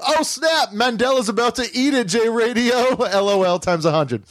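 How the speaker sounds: high, gleeful exclamation; mock excitement. Host acting out the joke writer's reply, mock excitement. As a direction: jump up in pitch with cartoonish glee.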